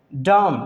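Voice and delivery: डैम ending on M, no N; silent N. Shown as a demonstration. Teacher explaining silent N, डैम ends on M. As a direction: say डैम stopping at M. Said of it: "एन यहाँ पे silent हो जाएगा".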